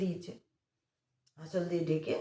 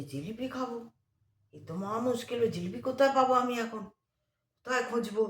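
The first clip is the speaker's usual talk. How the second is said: exasperated; 7 semitones higher than usual. Retold or imitated. Companions dismayed at old woman's wish.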